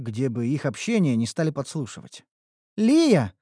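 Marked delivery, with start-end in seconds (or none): 2.8-3.3 put-on high, gushing girl's delight; thrilled elf girl greeting her friend. A narrator's reading. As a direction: admire her friend with bubbling joy. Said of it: "восхитилась Неуру".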